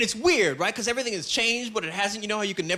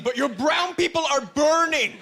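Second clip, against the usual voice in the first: high, urgent plea; mock alarm; 5 semitones higher than usual. Comic demanding action on his sunburn, mock alarm. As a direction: raise the pitch as if sounding an emergency.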